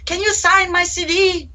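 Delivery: put-on high, eager girlish voice; teenage student pleading for a signed CD; pleading teen fan. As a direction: whine it like an excited young student. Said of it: "Shut up".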